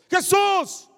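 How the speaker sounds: high, loud cry of the name; shouting. Acted. Blind man calls to Jesus passing by, shouting. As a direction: call out over a crowd. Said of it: "él estaba gritando".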